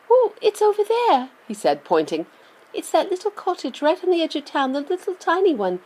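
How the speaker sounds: put-on high, eager child's voice; boy voice. A narrator's reading. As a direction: voice a young boy. Charlie voiced by narrator.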